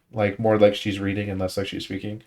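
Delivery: normal talking voice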